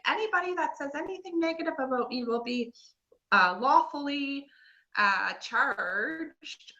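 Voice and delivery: high, pompous legal threat read; mock legal voice. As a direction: read it like a haughty lawyer. Reading lawyer's paper mockingly.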